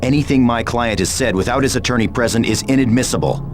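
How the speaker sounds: rapid-fire legal objection; rattled off like a lawyer; brisk lawyerly interjection. Acted. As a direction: cut in fast, officially shielding the client.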